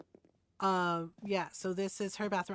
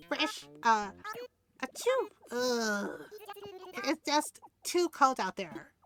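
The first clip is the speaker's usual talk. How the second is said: high cute villager voice; 5 semitones higher, slower than usual. Acted. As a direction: play the sneeze up big. Reads Bianca's sneezing line.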